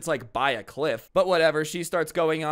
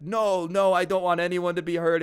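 hasty denial; 5 semitones higher, 4 dB louder than usual. Retold or imitated. Retold kid hastily denies finding it funny.

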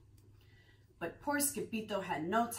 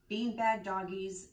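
usual speaking voice

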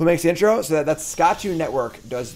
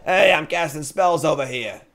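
slow, louder mock New York accent; 5 semitones higher, 7 dB louder than usual. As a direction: heckle like a cranky New Yorker. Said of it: "mixing up my New York and my Boston accents".

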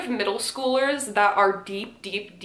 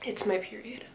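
a much softer, grave "It's my period"; grave confession; 10 dB quieter than usual. Pretend. Mocking the line as a doctor's grim report, softly. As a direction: deliver it like terminal news. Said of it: "like somebody's telling somebody about their terminal illness".

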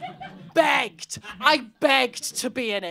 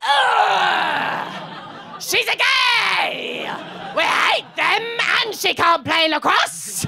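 disgusted mock shout; 7 semitones higher, much slower than usual. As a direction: shriek it in horror. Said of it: "And they went".

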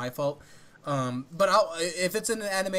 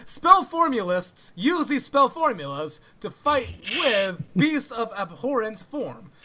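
higher, louder, slow flamboyant read; 4 semitones higher, 4 dB louder, slower than usual. Pretend. Restarting the summary after a stumble, fully hammed-up flamboyant. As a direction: declaim it with big theatrical swoops. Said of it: "flamboyant voice".

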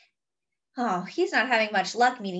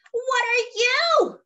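high, loud, slow frightened cry; 12 semitones higher, 4 dB louder, slower than usual. Acted. The lady by the farm sees a dragon, frightened cry. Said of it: "cries a lady".

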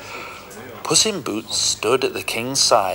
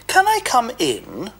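sweet, much higher pleading ask; nicest voice; 13 semitones higher than usual. Acted. Puss politely asking the ogre to enter, sweet and high. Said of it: "in his nicest voice".

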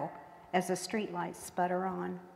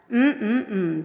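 savoring hum; 3 semitones higher, 8 dB louder than usual. A narrator's reading. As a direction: relish the food with a hum. Daddy savoring remembered stew.

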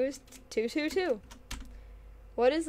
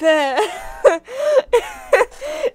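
helpless high-pitched cackling laugh; loud high laughing; 10 semitones higher, 9 dB louder than usual. Real, not acted. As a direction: burst out laughing loudly. Cracks up at own pronunciation.

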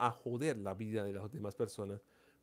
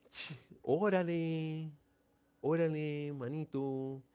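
mock Mexican slang; much slower than usual. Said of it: "Así hablan los mexicanos".

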